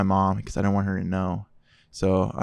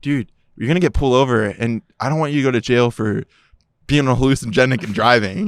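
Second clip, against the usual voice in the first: louder, urgent buddy warning; 3 semitones higher, 7 dB louder than usual. Retold or imitated. Buddy in the car warning the driver, urgent and loud. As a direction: warn him he'll get pulled over. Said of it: "my buddy in the car goes".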